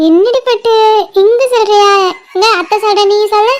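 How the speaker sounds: playful sing-song to an infant; cooing auntie chatter; coaxing baby talk. Acted. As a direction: coax a baby to babble back.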